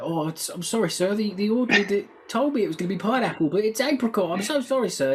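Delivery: high, fast, flapping apology; flustered servant. Retold or imitated. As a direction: play the panicky orderly begging pardon. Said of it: "he's flapping about".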